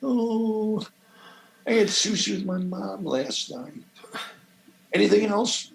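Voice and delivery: lightly voiced breezy LA wife; a hint of a picky Los Angeles wife turning it down; a touch of the choosy LA ex-wife. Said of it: "she's, like, so L A".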